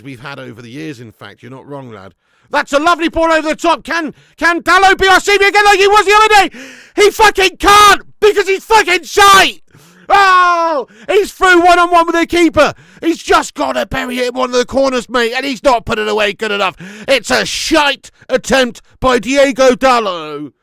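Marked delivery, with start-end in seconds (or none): 2.5-3.8 loud, high burst of excitement; excited shout. Real, not acted. Fan watching live, shouting at a great pass. 3.8-20.5 loud, high outraged yelling at the miss; frustrated shouting. Real, not acted. Fan watching live, shouting in frustration at Dalot. Dalot misses one-on-one chance.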